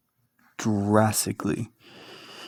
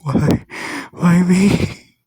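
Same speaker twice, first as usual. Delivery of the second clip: high, louder, cracking despair; laughing while weeping; 5 semitones higher, 3 dB louder than usual. A narrator's reading. Deku asking why him, laughing through tears. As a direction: cry out why between laughs. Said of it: "laughing while weeping".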